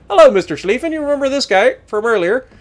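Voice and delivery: high, singled-out taunting hello; mock friendly greeting. Gamer greets the enemy ship just spotted, mock friendly taunt. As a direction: greet the prey with playful menace.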